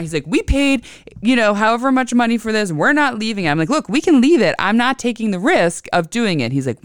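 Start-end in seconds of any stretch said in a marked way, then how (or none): 0.3-3.5 higher mock protest; retold indignant insistence. Retold argument, husband insists. 3.7-6.5 high, loud mock exasperation; retold firm refusal. Retold argument, she refuses.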